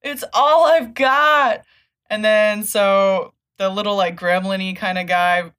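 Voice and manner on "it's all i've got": high, loud despairing cry; anguished pleading. Retold or imitated. Jerry crying out to his friend in despair, loud and high. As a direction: plead as if losing everything.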